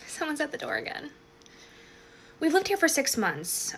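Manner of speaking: plain reading voice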